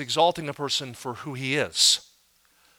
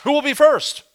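loud, high rally to the congregation; pastor calling for volunteers; 6 semitones higher, 7 dB louder than usual. Acted. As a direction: call out brightly for the first volunteer. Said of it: "How do you think the pastor feels".

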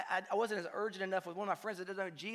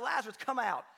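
loud call, pitched higher; crying out; 5 semitones higher, 5 dB louder than usual. Retold or imitated. Jesus calling Lazarus from the tomb, loud call. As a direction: call him out of the grave. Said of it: "he cries out".

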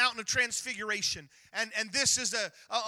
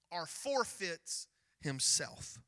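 slow drawn-out reading; 4 semitones lower, 7 dB quieter, much slower than usual. Acted. Pastor reading scripture to the congregation, drawn out. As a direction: stretch forfeits himself out slowly and low.

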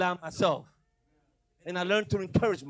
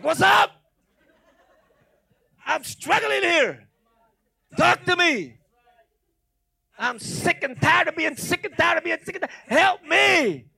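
much higher, much louder pleading cry; desperate shout to God; 11 semitones higher, 11 dB louder, slower than usual. Retold or imitated. Pastor acting out his desperate loud cry to God. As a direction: cry out to God at the end of your rope.

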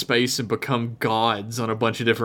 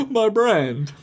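laughing; 11 semitones higher than usual. Real, not acted. Laughing echo of co-host.

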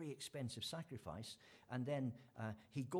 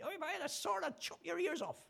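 much higher, louder, hammed-up angry rider; mock furious threat; 4 dB louder than usual. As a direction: bellow it like a fed-up man with his stubborn donkey. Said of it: "That's my own version".

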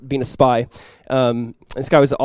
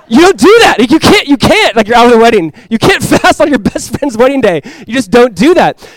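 much higher and louder, laughing disbelief; incredulous exclaiming; 6 semitones higher, 7 dB louder than usual. Real, not acted. Preacher to congregation, loud incredulous exclaim. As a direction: exclaim it like it's unthinkable. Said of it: "preacher exclaims in disbelief".

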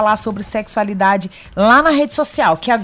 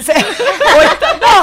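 laughing; 10 semitones higher, 4 dB louder than usual. Real, not acted. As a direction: talk through real laughter. Laughing at the joke.

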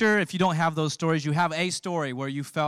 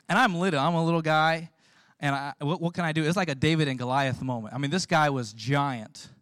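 ordinary talk, nothing marked

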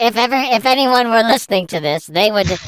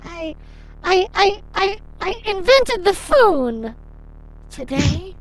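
Cindy voice; 9 semitones higher than usual. Acted. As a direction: play a lonely woman, pitched high.

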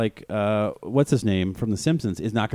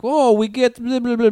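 high, loud mock Russian accent; put-on Russian voice babbling nonsense; 11 semitones higher, 5 dB louder than usual. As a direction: imitate a comedian hamming up a Russian accent. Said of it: "like, a Russian accent".

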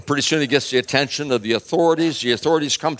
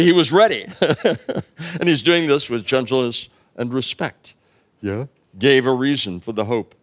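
ordinary delivery

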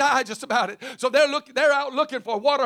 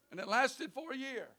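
much softer, hushed repeat; quiet echo; 15 dB quieter than usual. Real, not acted. Preacher echoes the miracle to the congregation, hushed and soft. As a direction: drop it to a near hush. Repeats the line, dropping low.

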